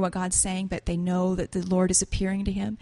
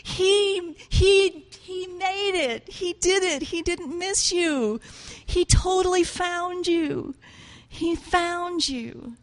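high, slow, choked-up plea; a hint of the tears, pitched up high and drawn out; reliving the moment of weeping, lightly; retold sobbing; 8 semitones higher, much slower than usual. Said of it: "And I just started sobbing".

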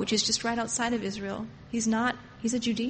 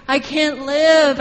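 much louder, slow, drawn-out cry of pain; anguished roar; 4 semitones higher, 10 dB louder, slower than usual. Retold or imitated. God roaring from within his body, loud slow anguish. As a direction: roar it slowly like a heart breaking. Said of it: "in his own body roaring".

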